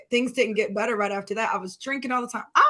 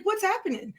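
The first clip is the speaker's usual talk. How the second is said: higher-pitched, bewildered question; retold confusion, pitched up; confused; 8 semitones higher, 4 dB quieter than usual. Asking a friend who turned up unexpectedly, confused. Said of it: "I was so confused".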